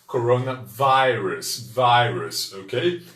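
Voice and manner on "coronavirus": coronavirus with the I said as "ai", slowly; slow careful coronavirus, vai-rus; English coronavirus. Shown as a demonstration. Teacher modelling coronavirus for viewers, slow and clear. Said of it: "Terá som de I".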